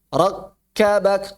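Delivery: "Rakkabak" with a silent stop on the first k; cut-off sound. Shown as a demonstration. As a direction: hold the k of "Rakkabak" closed before kabak.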